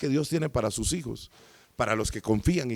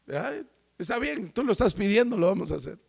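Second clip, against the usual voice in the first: resigned Simon; 7 semitones higher, 3 dB quieter than usual. Retold or imitated. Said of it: "me imagino a Simón en ese momento diciendo".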